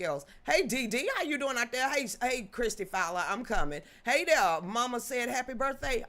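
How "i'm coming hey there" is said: normal talking voice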